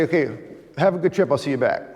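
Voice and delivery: quick, offhand farewell; breezy casual goodbye. Retold or imitated. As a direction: toss it off lightly, in a hurry. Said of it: "I'd say".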